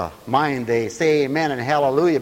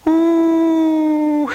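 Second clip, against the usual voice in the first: loud, high sustained hoot; long "ooh"; 14 semitones higher, 7 dB louder than usual. Real, not acted. Whoop after laughing demonstration.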